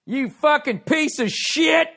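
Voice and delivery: high, slow, loud screaming insult; mock abusive coach yelling at a student; abusive movie coach. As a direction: spit it out like a tyrant drill instructor. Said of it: "that dude in that Drummond movie".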